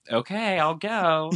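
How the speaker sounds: high, drawn-out agreeable mimicry; mock chill compliance. Host mimics Sandoval leaving with the cops, mock easygoing. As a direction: imitate someone meekly going along. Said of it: "was so chill about it".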